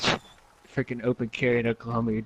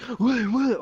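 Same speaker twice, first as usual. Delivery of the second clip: high-pitched confused what; startled questioning; 11 semitones higher than usual. Acted. Friend coming back to the mic, startled. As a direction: ask in mock alarm.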